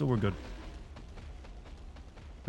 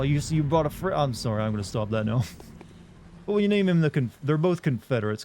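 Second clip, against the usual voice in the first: ordinary talk, nothing marked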